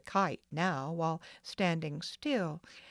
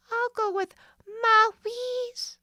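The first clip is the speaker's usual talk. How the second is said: put-on higher, louder, slow little-girl voice; pouting child; 9 dB louder, slower than usual. A narrator's reading. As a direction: lisp it like a sulky toddler.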